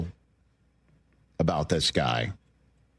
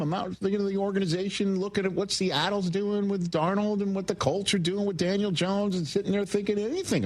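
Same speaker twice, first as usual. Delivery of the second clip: high, quick pitched-up voice; high register voice; 10 semitones higher than usual. Acted. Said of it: "high register take".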